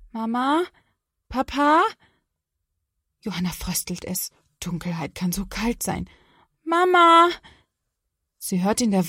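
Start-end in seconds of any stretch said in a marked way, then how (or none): 0.1-1.8 calling louder and louder. Acted. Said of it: "dann immer lauter ruft sie". 6.6-7.3 loud, high call for mom. Acted. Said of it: "dann immer lauter ruft sie".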